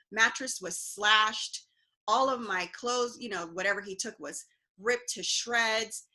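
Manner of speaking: normal talking voice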